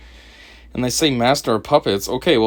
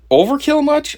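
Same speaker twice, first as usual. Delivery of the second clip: sarcastic; 4 dB louder than usual. Real, not acted. Mocking the many hidden cameras.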